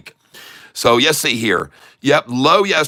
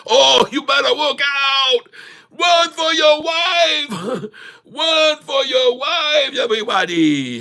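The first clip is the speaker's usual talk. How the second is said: mock alarm shout; 11 semitones higher, slower than usual. Host jokingly warning viewers, high playful shout. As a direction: holler it like a joke alarm.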